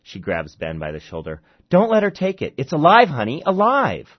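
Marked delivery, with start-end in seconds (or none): 1.7-4.1 high, loud desperate cry; frantic plea. Acted. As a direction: beg your husband in a panic. Frantic mother pleading with husband.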